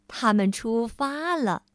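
ordinary delivery, nothing marked

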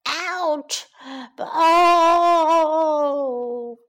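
ouch trailing into a long moan; acted pained wail. Beaten lion slinking away, long mock wail. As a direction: wail it as a beaten storybook animal.

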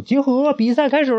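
queen excitedly announces the match; excited announcement. Acted. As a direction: ring the bell and announce gleefully. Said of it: "兴奋地拿出铃铛宣布".

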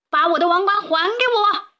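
high, insistent elephant voice; demanding elephant. A narrator's reading. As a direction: demand it back, pitched high. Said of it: "大象说".

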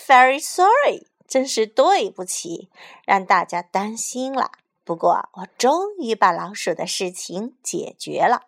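mayor's hammed-up speech voice; enthusiastic speech. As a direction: orate to the crowd with showy fervor. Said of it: "市长一番热情洋溢的演讲".

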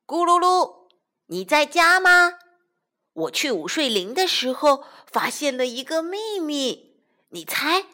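loud, high eager call. Acted. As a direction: shout it through the door. Calling at friend's door.

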